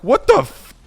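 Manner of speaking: high, loud startled exclamation; shocked outburst. Retold or imitated. Hearing she saw a bear, loud shocked outburst. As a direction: blurt out in shock. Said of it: "I'm like".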